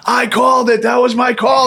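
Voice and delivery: retold friend shouting his triumph; boastful shout. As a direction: crow about calling the play. Friend bragging about his call.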